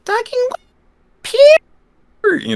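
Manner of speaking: extremely high squeaky warm-up voice; really high voice. Shown as a demonstration. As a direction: push the voice up as high as it will go. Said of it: "practicing really high voices".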